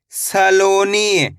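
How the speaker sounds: slow teacher model of Saloni; very slow blend. Shown as a demonstration. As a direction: stretch Saloni out slowly, syllable by syllable merged. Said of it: "teacher blends syllables very slowly".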